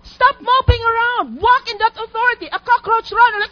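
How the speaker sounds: preacher rallying the church to courage; full-force preaching. Acted. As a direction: rouse the congregation.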